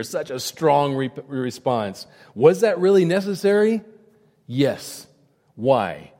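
ordinary talk

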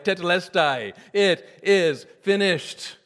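crying out. Retold or imitated. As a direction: cry it out word by word in triumph. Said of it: "you know what he cried out".

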